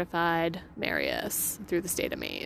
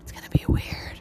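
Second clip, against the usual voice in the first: whisper. Real, not acted. Whispered aside.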